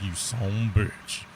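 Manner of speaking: slow, drawn-out mock curse; mock exasperation. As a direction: stretch it out in playful outrage. Retold reply to the developer.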